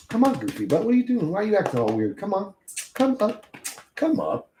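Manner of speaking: high sing-song coaxing; high coaxing voice calling the dog up. Real, not acted. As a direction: coax the dog up in a high pet voice. Baby talk to the dog.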